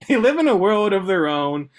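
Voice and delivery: can't hold it together, laughing while reading; laughing through the line. Real, not acted. Reading a book quote aloud to a friend, laughing.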